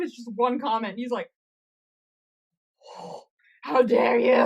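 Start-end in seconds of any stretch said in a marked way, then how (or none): 3.0-4.3 loud, hammed-up outrage; mock indignant yell. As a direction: yell it like an offended drama queen. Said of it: "after all this yelling".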